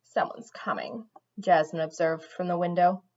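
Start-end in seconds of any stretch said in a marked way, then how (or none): 0.1-1.1 quiet. A narrator's reading. Jasmine voiced in read story.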